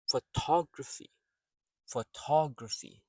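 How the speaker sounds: "Photography" as fuh-TOG-ruh-fee; soft first syllable, strong "tog" in "Photography"; stress on second syllable. Shown as a demonstration. Teacher demonstrates word rhythm, "Photography" stressed on its second syllable. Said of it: "the first syllable is soft, unstressed".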